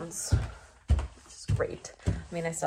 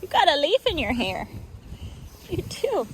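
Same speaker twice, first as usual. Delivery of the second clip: talking to a horse; 6 semitones higher, 5 dB louder than usual. Real, not acted.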